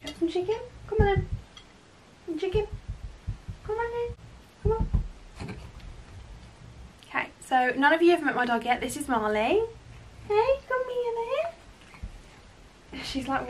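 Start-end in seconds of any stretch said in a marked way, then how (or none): 0.0-4.9 dog voice. Real, not acted. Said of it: "Excuse my dog voice as well". 10.3-13.0 high, slow cooing call to the pet; dog voice. Real, not acted. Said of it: "Excuse my dog voice as well".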